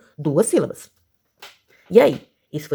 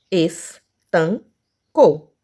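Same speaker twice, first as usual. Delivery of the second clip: "Estancou" very slow, es-tan-cou; syllable by syllable; 4 dB louder, much slower than usual. Shown as a demonstration. Teacher showing three syllables, "Estancou" slowly separated. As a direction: pause after each syllable of "Estancou". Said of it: "três sílabas".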